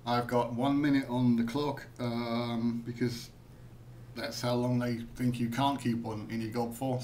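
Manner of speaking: usual speaking voice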